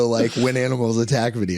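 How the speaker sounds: ordinary talk, nothing marked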